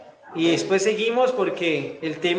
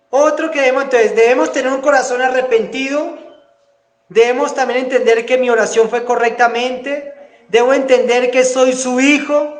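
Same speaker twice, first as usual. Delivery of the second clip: loud, higher sermon delivery; preaching at full force; 5 semitones higher, 5 dB louder than usual. Real, not acted. Pastor exhorting the congregation on a repentant heart, loud preaching. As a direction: push each "debemos" out to the back pews.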